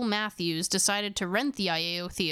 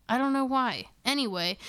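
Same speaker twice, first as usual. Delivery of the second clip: ordinary delivery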